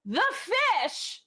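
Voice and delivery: high excited cry of the smith; blacksmith NPC exclaiming. Acted. Smith Lildrir to the player, a high excited cry. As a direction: shout it out high like a thrilled smith. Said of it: "she turns to you and goes".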